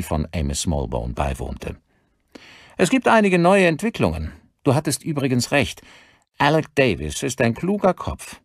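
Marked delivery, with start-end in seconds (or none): 2.8-8.4 higher-pitched, eager colleague voice; detective colleague on the phone. Acted. Colleague phones the detective with new developments, higher-pitched and keen. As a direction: report the news briskly, pitched up.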